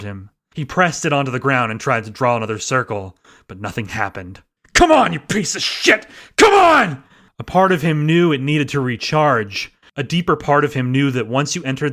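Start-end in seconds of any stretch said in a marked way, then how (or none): 4.7-7.0 high, loud tearful cursing; desperate crying shout. A narrator's reading. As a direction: yell through tears at the dead tool. Desperate, crystal not working.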